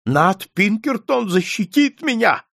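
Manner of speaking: high, agitated cry of faith; insistent old man. A narrator's reading. Old man counts on Pinkerton's protection, high and insistent.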